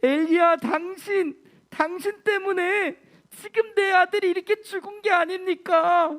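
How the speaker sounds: high, loud tearful wail at Elijah; acted widow sobbing out her grief; crying accusation. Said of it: "라고 하면서 울면서".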